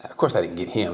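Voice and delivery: ordinary talk, nothing marked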